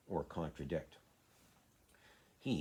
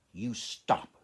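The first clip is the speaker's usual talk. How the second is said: sharp, loud command, pitched up; almost shouting; 5 semitones higher, 8 dB louder than usual. A narrator's reading. Mary nearly shouts at the screaming boy to stop. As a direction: snap it out at him like a furious child. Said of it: "she almost shouted".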